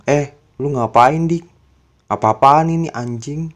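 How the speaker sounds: retold shouting in outrage; angry shout. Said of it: "Dia bener-bener marah banget".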